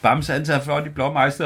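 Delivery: usual speaking voice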